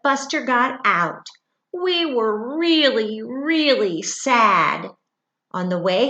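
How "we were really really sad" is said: slow, drawn-out sad read; mournful read-aloud; sad storytelling. A narrator's reading. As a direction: let the sadness stretch out.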